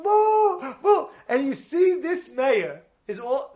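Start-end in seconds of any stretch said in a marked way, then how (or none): 0.0-1.1 high, drawn-out imitated boo; crowd booing. Whole rally going at the mayor, high drawn-out booing. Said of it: "And they're all going".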